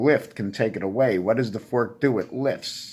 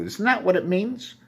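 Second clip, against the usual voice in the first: ordinary delivery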